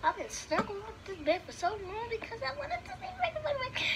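high-pitched eager gush; excited longing. Retold or imitated. Child acting out longing for Funny Mike, high eager gush. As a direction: plead your longing in a high, softened squeal.

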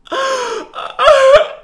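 loud, high horrified gasp; shocked gasp. Acted. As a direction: gasp in horror at the news. Mother learns daughter can't leave.

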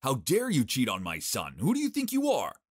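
loud, high furious yelling. Acted. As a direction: rage at her. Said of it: "Patrick's parents were furious".

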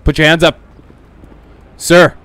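loud, stern police orders; shouted commands. Acted. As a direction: bark the commands at the suspect. Cop commanding suspect.

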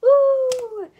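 cheer. Real, not acted. Cheering after naming her state.

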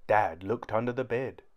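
plain reading voice

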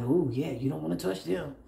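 lightly voiced onlookers warning others off; a hint of knowing gossip; imitated gossips. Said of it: "That's how they sound".

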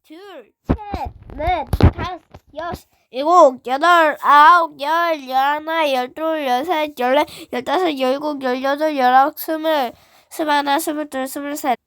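loud workout count, drill style; shouted counting. Acted. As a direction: bark out each rep number.

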